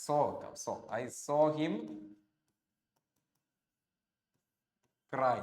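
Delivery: very slow, each word apart as if writing on the board; slow dictation. Shown as a demonstration. Teacher reading a board example aloud to students, slowly. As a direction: dictate "I saw him cry" word by word. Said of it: "teacher dictates example while writing".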